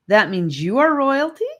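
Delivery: high, loud amazed ask; astonished question. A narrator's reading. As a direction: ask in wide-eyed surprise.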